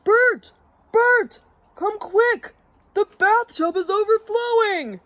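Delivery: high, loud cries for help; urgent yell. A narrator's reading. Ernie summons Bert to a flood, high urgent yell. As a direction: shout the alarm in a high voice. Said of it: "Ernie yelled".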